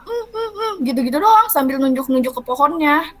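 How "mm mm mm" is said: high, soft closed-mouth "mm" noises; imitated wordless mumbling. Imitating a friend speechless after screaming, high muffled mumbles. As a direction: imitate someone unable to speak, humming high and quiet. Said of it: "kayak gak bisa ngomong, kayak cuma".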